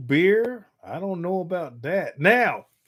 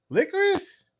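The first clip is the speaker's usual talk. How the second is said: high, rising disbelief; surprised at the word licorice; Licorice? squeaked high. Real, not acted. Surprised question.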